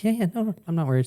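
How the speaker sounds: high-pitched mock reassurance; lightly acted "no worries"; quick reassurance. Acting out reassuring his companion.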